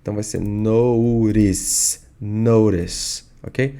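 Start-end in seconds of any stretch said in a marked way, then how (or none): 0.4-2.0 slow American "notice", T sounding like R; American tapped T. Shown as a demonstration. Teacher explaining the T sound, American "notice". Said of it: "O T tem som de R". 2.2-3.2 American "notice" with flapped T; American tapped T. Shown as a demonstration. Teacher repeating for students, American "notice". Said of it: "O T tem som de R".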